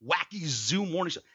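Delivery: high, hammed-up morning-jock parody; wacky zoo radio host. Mocking bad radio jocks, wacky zoo-host voice. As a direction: honk and squawk like a cheesy radio DJ. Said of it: "Not every radio guy sounds like".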